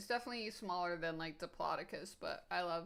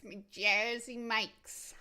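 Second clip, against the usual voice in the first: louder, slow My Jersey Mike's in a hammed-up British-New York accent; mixed British-New York accent; 3 semitones higher, 6 dB louder, much slower than usual. Streamer to chat, repeating her sandwich name in a louder mock British-New York accent. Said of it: "mixing my British accent and my New York accent".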